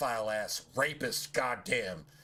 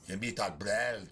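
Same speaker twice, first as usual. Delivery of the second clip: slow hammed-up fake Irish-ish elf accent; mock bad elf accent; 4 semitones lower, slower than usual. Gamer parodies elf guard's threat, slow fake Irish-sounding accent. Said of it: "Talk in the worst accent I've ever heard in a video game".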